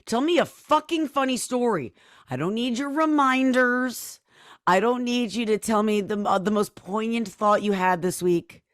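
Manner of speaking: mock indignation. Mock telling-off over a reminder.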